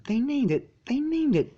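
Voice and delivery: high indignant repeat; high scandalised mare voice; outraged unicorn. A narrator's reading.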